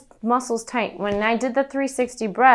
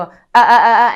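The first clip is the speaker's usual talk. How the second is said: loud throat-pushed ah, ah; throaty ah; 11 dB louder than usual. Shown as a demonstration. Coach shows students' mistake, loud throaty ah. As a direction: squeeze it from the throat, the wrong way. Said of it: "a lot of times they'll go".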